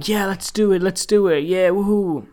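high, loud hammed-up hype; mock egging on. An adult egging a teenager on, hammed-up and high. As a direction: cheer her on like a reckless adult. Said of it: "egging on that and going".

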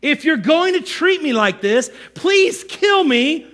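high, hammed-up pleading complaint; Moses as victim. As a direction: protest to God like a put-upon martyr. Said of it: "Moses says".

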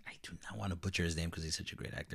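hurried whisper; fast nervous whisper. Real, not acted. Worried about mispronouncing.

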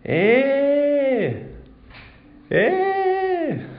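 put-on very high, thin call of an old man; an old man calls from the end of the carriage; high cracked elderly shout of Eh. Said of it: "suena una voz al final del vagón".

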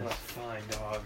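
soft cooing at a dog. Real, not acted. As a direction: coo to the puppy.

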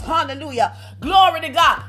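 ordinary talk, nothing marked

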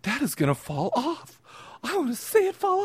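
mock the wife's giggly fascination; imitating wife. Husband imitating his wife mesmerized by an actor's hair, lightly voiced. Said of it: "she's like".

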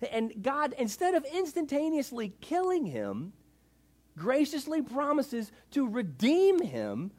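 high-pitched, slow, incredulous tirade; R C Sproul's indignant rant. Retold or imitated. Old theologian explaining to the crowd, indignant high-pitched scolding. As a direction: hammer out each phrase in outrage. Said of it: "a little gruff".

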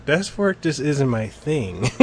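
slow, spelled-out line reading; feed him the line slowly; slow line prompt. Shown as a demonstration. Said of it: "was it".

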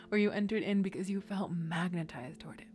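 hushed whisper. Acted. Whispered aside continues.

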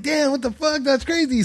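high, stunned damn what the fuck; retold amazement. Telling a friend about the coworker's jail story, high amazed reaction. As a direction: react in shocked disbelief. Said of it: "And I'm like".

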